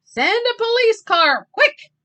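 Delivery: loud, high shout; urgent yell. Acted. Michael yells to Clancy on the phone, loud and urgent. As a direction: bark the order urgently. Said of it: "yelled Michael".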